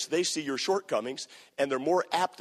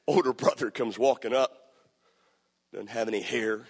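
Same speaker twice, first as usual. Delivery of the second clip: usual speaking voice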